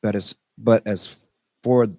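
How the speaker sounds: slow, measured verse with long pauses; unhurried recital of a poem; poem reading. Acted. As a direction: read it line by line, letting each phrase sit. Reads a Rumi poem aloud.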